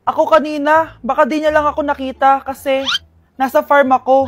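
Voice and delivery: put-on loud defensive kid excuse; loud fibbing child. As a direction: shout the lie to cover it up. Kid lying loudly to mother.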